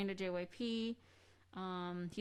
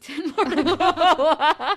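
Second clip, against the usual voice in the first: laughing through the words; slow and loud, bursting into laughter; 7 semitones higher, 16 dB louder than usual. Real, not acted. Laughing about [name].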